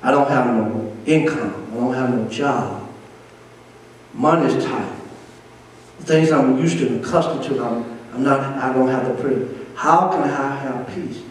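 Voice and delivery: a hint of a troubled member of the church; voicing a doubter. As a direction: speak as a worried churchgoer. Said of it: "that sounds ludicrous, Pastor".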